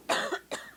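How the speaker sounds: short dry cough, unvoiced; a single real dry cough. Real, not acted. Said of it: "I've got a cough".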